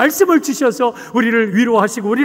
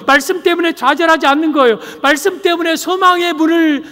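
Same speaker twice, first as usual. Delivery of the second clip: high-pitched sermon climax growing louder; impassioned preaching; 7 semitones higher, 5 dB louder than usual. Real, not acted. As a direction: drive each phrase home to the congregation.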